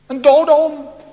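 loud, high cry of self-contempt; shouted quote. Retold or imitated. Preacher shouting Mephibosheth's humble words to the congregation. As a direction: fling the words out at full voice. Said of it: "Hij hoeft alleen maar te zeggen".

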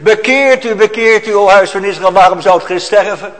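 loud, high call to repent; a preacher's loud high cry to turn back; preaching shout. Acted. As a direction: thunder it out from the pulpit.

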